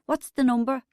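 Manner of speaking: brusque shouted question. A narrator's reading. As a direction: demand it loudly. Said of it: "she shouted at me".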